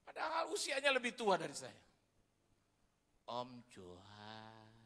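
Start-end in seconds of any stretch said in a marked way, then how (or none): 3.3-4.8 slow, soft respectful call; slow soft address; humble guest. Retold or imitated. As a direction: call him gently and humbly.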